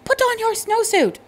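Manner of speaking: high, loud frantic order; a teacher losing patience; exasperated teacher yell. A narrator's reading. Teacher jumping up and down, yelling at Thomas. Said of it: "The teacher jumped up and down".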